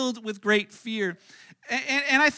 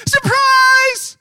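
much higher, loud yelled surprise; party surprise shout; 13 dB louder than usual. Pretend. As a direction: yell it as the lights come on.

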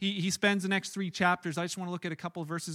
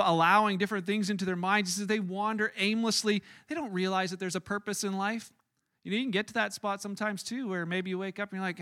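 ordinary talk, nothing marked

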